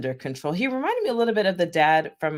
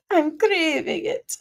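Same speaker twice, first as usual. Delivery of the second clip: longing craving; 12 semitones higher, slower than usual. Real, not acted. Craving food.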